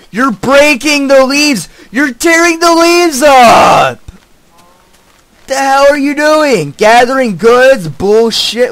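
high, loud, slow outraged shout; furious yelling. Real, not acted. Player yells at a villager who stole his tree, outraged shouting. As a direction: scream at him for wrecking your tree.

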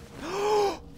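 sharp gasp. Real, not acted. Realizes character died.

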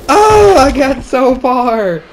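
dismayed wail. Real, not acted. Just fell after long climb.

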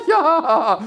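loud, high laughing "ja". Real, not acted. Host cracking up at his own sarcasm, laughing. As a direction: burst out laughing on the word.

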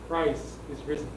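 normal talking voice

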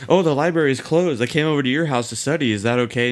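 play-acted fluster, words tumbling out fast; panicky rehearsed excuse. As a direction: imitate a girl anxiously practicing her excuse. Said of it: "like, panicking".

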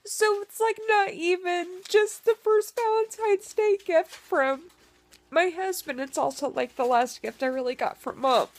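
fighting back real tears as she talks; tearful. Real, not acted. Telling viewers about her late mom's last gift, high tearful voice. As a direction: voice climbing high and choking up, close to tears. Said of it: "I'm being a big baby today".

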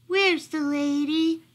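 slow, high, plaintive question; searching dog. A narrator's reading. Lost dog looking all around, searching plaintively. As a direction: draw it out high, longing for the owner.

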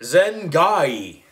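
a lightly voiced wizard incantation, a touch loud. Boy playing wizard says the magic word, lightly voiced. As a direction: intone it like a kid playing a wizard. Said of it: "in my best wizard voice".

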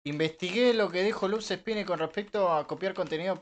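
ordinary talk

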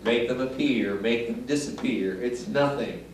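hushed, slow showman build-up to the reveal; mock magician patter. Magician to audience, hushed mock-grand patter. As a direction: ham up the hushed magic-act announcer.

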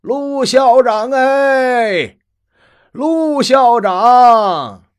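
long drawn-out high call across a courtyard; loud calling out. Acted. Old grandfather calling the principal in the schoolyard, long loud hail. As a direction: hail him from afar, slow and loud. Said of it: "大声地吆喝".

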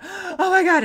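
high, loud gasp of panic; retold freak-out. Patient pushed back into the scanner, acted panic. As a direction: burst out in acted alarm. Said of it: "Freak out".

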